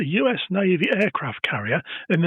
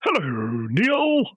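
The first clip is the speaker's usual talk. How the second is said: mock phone greeting; 3 semitones higher, much slower than usual. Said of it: "Let's try that again".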